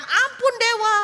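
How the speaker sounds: high, loud wailing for mercy; terrified pleading. Acted. Kneeling tribe begs the false god for mercy, wailing. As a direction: beg for your life, shrieking.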